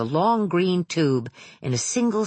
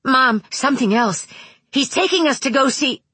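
put-on higher, louder eager child; urgent boy voice; 9 semitones higher, 4 dB louder than usual. A narrator's reading. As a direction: call out to mom, high and quick. Wayne calls out to mother.

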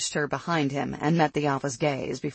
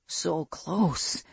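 restrained animal growl; 2 semitones higher than usual. A narrator's reading. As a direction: growl it as the inner wolf. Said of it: "his wolf growled".

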